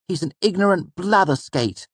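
put-on high boy's voice, bitter and resentful; a sulking boy's bitter jab. A narrator's reading. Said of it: "I said bitterly".

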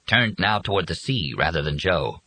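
usual speaking voice

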